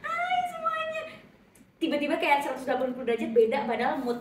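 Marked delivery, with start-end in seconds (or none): 0.0-1.0 sudden high, cheerful hello to fans; switching from sadness to a high chirpy hello; bright public greeting. Retold or imitated. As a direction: flip instantly into a beaming greeting for the fans. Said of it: "aku bisa yang kayak".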